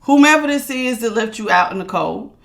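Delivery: ordinary delivery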